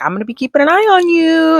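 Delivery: high mock-stern teacher voice; a teacher jokingly warning the kid, high and playful. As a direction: pitch it way up, teasing a warning. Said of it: "So she's like".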